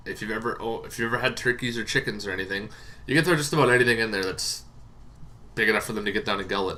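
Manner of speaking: ordinary delivery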